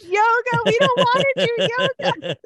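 very high, loud imitated outcry; mock screaming protest. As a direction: screech it like a hammed-up mob refusing. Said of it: "they just start screaming".

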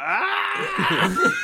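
very high mock scream; freak-out scream. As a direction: pretend shriek of overjoyed old friends. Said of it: "freak out".

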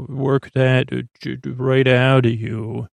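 usual speaking voice

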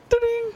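very high, ringing "ding"; imitated text alert ding. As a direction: chime like a phone notification.

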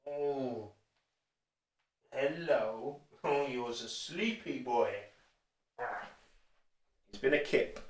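soft cooing to a sleepy dog; pet talk. Real, not acted. Streamer softly coos to his sleepy dachshund Minton, hushed pet talk. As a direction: coax him gently, hushed and fond.

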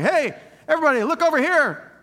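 high, loud, whiny public complaint; mock someone broadcasting their grievance to everyone; airing dirty laundry. Pastor mimicking a churchgoer complaining about Joe Dixon, high and loud. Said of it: "That's not love".

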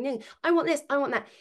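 put-on high, whiny kid voice; mock a child nagging for attention; lightly voiced whining kid; mimicking a whiny child.

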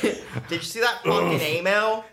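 mock Aussie panic. Players acting out the emu war, mock Australian alarm. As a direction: play an alarmed Aussie for laughs.

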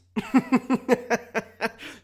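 loud, high burst of laughter; laughing. Real, not acted. Podcaster amused by own joke, loud laughing. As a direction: crack up in a rapid cackle.

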